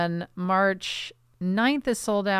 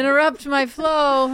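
indignant; 6 semitones higher, 5 dB louder than usual. Pretend. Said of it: "talking back to my poem".

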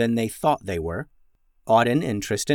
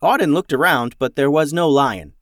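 ordinary delivery, nothing marked

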